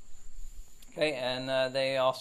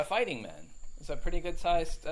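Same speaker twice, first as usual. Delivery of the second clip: ordinary talk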